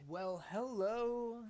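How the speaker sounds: slow smooth seductive voice; a suave charmer's greeting. Retold or imitated. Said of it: "swivels around in his lounge robe like".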